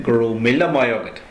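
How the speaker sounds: míle stressed, the phrase falling at the end; emphasis on míle. Shown as a demonstration. Teacher adding weight on míle for extra thanks. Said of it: "put more emphasis on the thanks".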